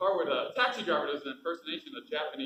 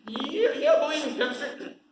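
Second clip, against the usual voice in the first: laughing; 9 semitones higher, 5 dB louder than usual. Real, not acted. Laughs, high, flirting.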